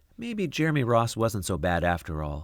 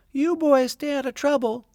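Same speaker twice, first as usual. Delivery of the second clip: much higher, louder mom voice, smiling; cheerful mother warning the boys; mom, smiling, high and bright; 5 dB louder than usual. Acted. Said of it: "she said, smiling".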